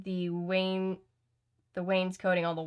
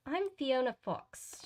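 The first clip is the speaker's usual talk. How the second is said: slow, high, sweetly British introduction; sweet, higher-pitched and unhurried; 6 semitones higher, 3 dB quieter, slower than usual. A narrator's reading. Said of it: "she said sweetly".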